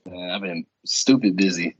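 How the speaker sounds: ordinary talk, nothing marked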